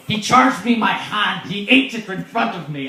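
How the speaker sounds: high, loud pirate yarn. Acted. Pirate recounting the cannibals who caught him, loud pirate voice. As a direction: tell it as a hammed-up pirate. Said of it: "he's like".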